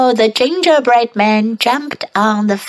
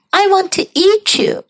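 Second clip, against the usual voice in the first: high voice; 9 semitones higher than usual. Shown as a demonstration. Practice take.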